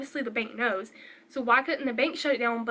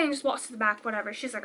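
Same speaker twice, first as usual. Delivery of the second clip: usual speaking voice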